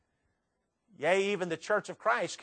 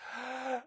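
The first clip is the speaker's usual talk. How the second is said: deep inhale; 6 semitones higher, 12 dB quieter than usual. Shown as a demonstration. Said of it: "take a big old deep breath".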